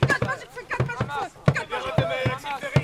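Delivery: very high repeated callout; shouting orders over battle. Real, not acted. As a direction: shout the warning to everyone. Calling out fire mages in battle.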